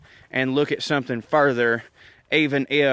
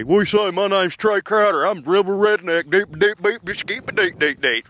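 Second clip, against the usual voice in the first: Trey with a sinus infection; 5 semitones higher, 3 dB louder than usual. Retold or imitated. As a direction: sound like a congested good ol' boy. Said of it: "give that person a sinus infection, and that's Trey".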